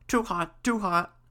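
high little repeated yelp; crying out. A narrator's reading. Kettle jumps off the hearth, crying out. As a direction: squeal it out high, in short bursts.

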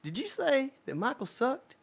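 high, pointed mock challenge; confronting challenge. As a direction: square up to him with a sharp question. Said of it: "Steve said".

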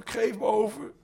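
high, loud cry rising at the end; shouting. Acted. Habuli pleads with his attackers, rising high shout. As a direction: shout it high as if about to faint. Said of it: "schreeuwde Habuli".

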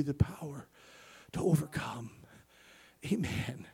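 whispered promise after the shouting; sudden whisper. Acted. Preacher hushing the church about God's power, whispering. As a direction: drop to a whisper.

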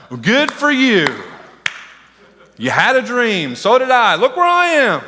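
sarcastic, slowing on the last line; bitter sarcasm. Pretend. Preacher as a hurt Joseph sneering at the dreamer. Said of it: "I'd be like".

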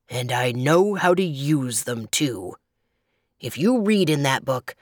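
growling storybook grizzly; deep, growly bear. A narrator's reading. Grizzly boasting of his cruelty to little girls, deep growl. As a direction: growl it out, relishing the wickedness. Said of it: "in a low, deep, growly voice".

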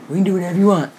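mock passive-aggressive echo. Woman echoes her date's dodge in scorn, passive-aggressive. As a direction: repeat his line as understated mimicry. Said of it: "lame-ass passive-aggressive bullshit".

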